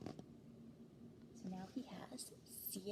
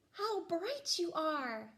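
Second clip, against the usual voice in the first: higher, slow delighted praise; 6 semitones higher, 4 dB louder, much slower than usual. A narrator's reading. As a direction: marvel at the glowing fish. Admires lanternfish.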